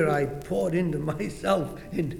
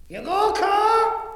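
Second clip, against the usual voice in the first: loud, high call into the dark; 12 semitones higher, 6 dB louder, slower than usual. Acted. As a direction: shout for the servant. Calling out for servants.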